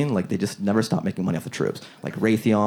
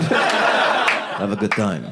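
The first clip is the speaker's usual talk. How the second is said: 1 creepy slow send-off; 3 semitones lower, much slower than usual. Retold or imitated. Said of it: "like I was gonna go fuck my food".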